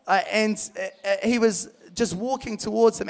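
normal talking voice

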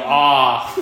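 "Ah" as a loud, drawn-out Boston AW. Retold or imitated. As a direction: open "Ah" wide with no R. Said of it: "pronounce A R as A W".

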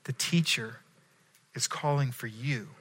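softly voiced hushed message; retold whisper. Martha to Mary at the house, a soft retold whisper. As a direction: drop low and soft, like telling a secret. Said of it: "she whispers in Mary's ear".

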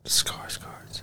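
hushed secret whisper. Pretend. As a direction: breathe the name in a whisper. Hiding, whispering the name.

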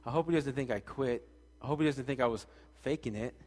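anxious inner voice. Retold or imitated. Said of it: "I'm thinking".

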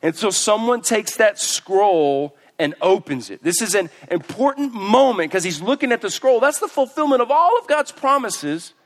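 loud, high impassioned preaching. Acted. Preacher building up to the congregation, impassioned.

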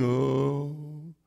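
mopey Eeyore sigh. Retold or imitated. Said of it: "We have a lot of Eeyore Christians".

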